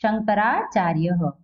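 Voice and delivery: slow शंकराचार्य with "ha" added at the end; visarga as "ha". Shown as a demonstration. Teacher showing visarga without matra, slow final "ha". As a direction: sound out शंकराचार्य syllable by syllable, closing on "-ya-ha". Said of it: "मात्रा नहीं है इसलिए ह".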